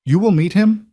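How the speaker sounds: put-on thick Polish accent lifted up high; Polish accent, questioning. A narrator's reading. Housekeeper's accented voice.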